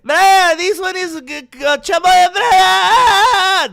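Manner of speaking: over-the-top fighter shouting at the camera; Chimaev impression. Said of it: "That's how Chamoyev talks".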